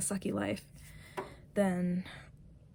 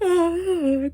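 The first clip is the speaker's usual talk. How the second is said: embarrassed laugh; 11 semitones higher, 10 dB louder than usual. Real, not acted. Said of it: "I wasn't legit crying".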